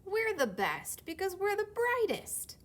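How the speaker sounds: high, loud protest; squeaky loud objection; indignant yellow. Acted. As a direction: push back hard against the reds. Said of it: "The yellows disagreed".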